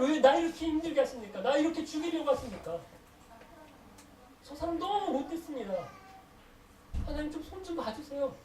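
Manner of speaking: high, exasperated acted plea, loud at first; venting complaint to God. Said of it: "하나님 앞에 토해내다 보면". (하나님께 따지듯 높은 목소리로 하소연)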